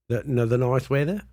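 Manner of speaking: ordinary talk, nothing marked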